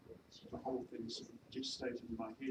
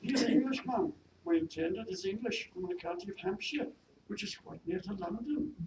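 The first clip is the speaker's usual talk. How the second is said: higher Welsh maid; attempted Welsh accent; 7 semitones higher, 6 dB louder than usual. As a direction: protest earnestly in a Welsh accent. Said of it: "my attempt at a Welsh accent".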